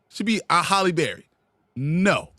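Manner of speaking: ordinary talk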